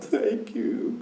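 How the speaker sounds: high, breathy-crying thank you; imitating tearful thanks. Stepson thanking his father for the water, high tearful voice. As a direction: mimic his son thanking him through tears.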